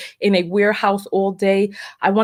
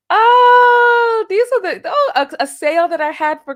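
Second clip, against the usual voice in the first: excited; 12 semitones higher, 6 dB louder than usual. Real, not acted. Finds her old Christmas sale.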